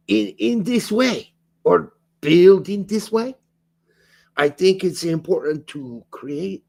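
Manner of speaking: put-on lightly German-accented coach quote. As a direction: read it like Pochettino, German accent. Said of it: "your German accent for Poach".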